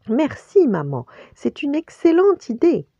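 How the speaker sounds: high, polite little-rabbit voice; lapereau très poli. A narrator's reading. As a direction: thank mother politely, high and loud. Pampan répond poliment à contrecœur. (petite voix aiguë et polie)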